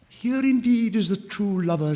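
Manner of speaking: an admiring songbird; nightingale. Acted. Nightingale speaks.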